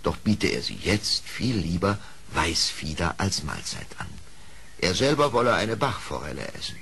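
plain reading voice

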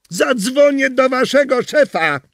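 high, slow shrill-free threat; woman's threat shouted. Acted. Neighbour shouting threat at the garbagemen. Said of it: "krzyknęła".

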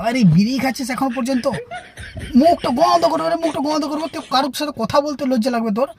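mocking the snob telling friends off; haughty scolding. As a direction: look down on the bidi smokers. Snob scolding bidi smokers.

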